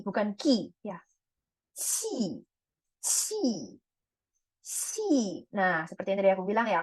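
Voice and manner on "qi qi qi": qi with a long puff of air and falling tone; airy qi. Shown as a demonstration. Teacher modelling Q for learners, aspirated qi. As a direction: draw out the airy hiss at the start of qi. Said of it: "dia itu juga ada anginnya".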